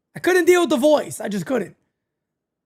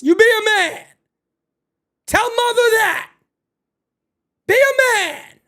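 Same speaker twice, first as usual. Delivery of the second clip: yelling at TV; 9 semitones higher than usual. Real, not acted. Yelling at the TV show.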